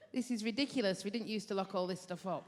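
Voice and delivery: a hint of a jaded veteran nurse counting pills; impression of Kerry, a grumbling veteran nurse. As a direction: mutter it like a fed-up senior nurse at the drug cupboard. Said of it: "this is Kerry doing the count".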